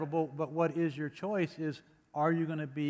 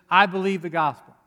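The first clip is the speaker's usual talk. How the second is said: bold declaration; 3 semitones higher, 10 dB louder than usual. Acted. Preacher voicing Paul's declaration.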